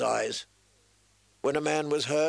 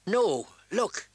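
higher-pitched urgent call to look; urgent pointing out; 7 semitones higher than usual. A narrator's reading. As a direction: draw their eyes to it sharply. Wildling woman points out the dead.

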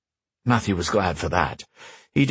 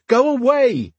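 high loud shout; 4 dB louder, slower than usual. A narrator's reading. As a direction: yell it through the door. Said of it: "shouted Matthew".

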